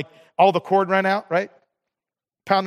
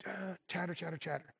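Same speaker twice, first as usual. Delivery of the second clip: chatter, chatter said much softer, trailing off; fading chatter; 3 semitones lower, 23 dB quieter than usual. Acted. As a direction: mutter it like background noise. Mimicking chatter in head.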